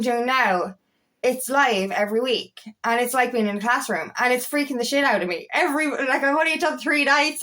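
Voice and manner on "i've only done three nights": usual speaking voice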